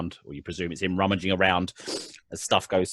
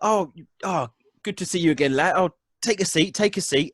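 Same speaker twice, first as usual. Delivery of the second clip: high-pitched, chummy halfling voice; the halfling host waving you to a seat, pitched up; halfling's accent; 9 semitones higher than usual. Acted. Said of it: "and he's like".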